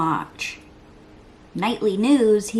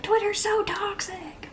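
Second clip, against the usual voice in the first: mock everybody; 12 semitones higher, slower than usual. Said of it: "Everybody's like".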